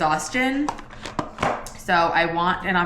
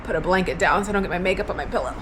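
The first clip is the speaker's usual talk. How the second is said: ordinary delivery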